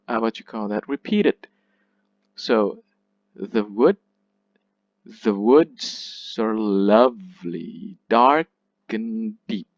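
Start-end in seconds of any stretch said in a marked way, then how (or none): 5.2-9.7 slow beat on WOODS, LOVELY, DARK and DEEP; slow, measured iambic beat, leaning on woods, lovely, dark, deep; metrical scansion. Shown as a demonstration. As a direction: read it as verse, drawing out each stressed beat in woods, lovely, dark, deep. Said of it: "the pattern is repeated one, two, three, four".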